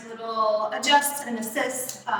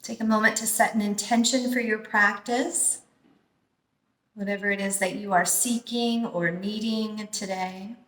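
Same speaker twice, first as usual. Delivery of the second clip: guided meditation. Acted. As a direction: ease them into calm breathing, unhurried. Leads a guided breathing meditation.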